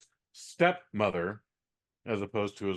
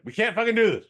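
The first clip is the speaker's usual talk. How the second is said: high, loud imitated yell; husband yelling at his wife Brandy; mock yell; 11 semitones higher, 6 dB louder, faster than usual. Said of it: "yells at his wife".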